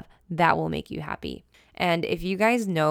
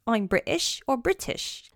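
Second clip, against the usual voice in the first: higher-pitched put-on British accent; 5 semitones higher than usual. Host playfully introducing herself to viewers, British accent. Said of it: "do it in my British accent".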